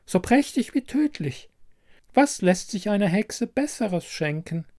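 high witch voice, relishing the words; high-pitched witchy recommendation; old herb witch. A narrator's reading. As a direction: present a deadly gift like an old crone. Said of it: "riet sie ihrem Besucher".

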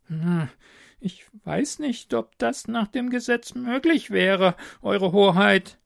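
hesitant royal adviser. A narrator's reading. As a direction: object carefully and politely. Adviser voiced by narrator.

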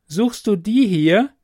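high, slow, ringing challenge; clear commanding voice. A narrator's reading. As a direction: hold up the prize and dare him. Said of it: "fragte sie mit klarer Stimme".

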